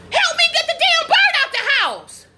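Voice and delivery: high, drawn yell of frustration; exasperated shout. Retold or imitated. Mother answering her oldest daughter, exasperated shout. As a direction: yell the obvious at a useless helper.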